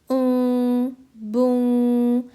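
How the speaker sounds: "ऊ, बू" as long rounded nasal hums; rounded-lip nasal ikhfa. Shown as a demonstration. Teacher showing do pesh ikhfa, rounded lips, stretched nasal u. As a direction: round the lips on "बू" and hold it through the nose. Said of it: "यहाँ पर अच्छे से होंठ गोल करके पढ़िएगा".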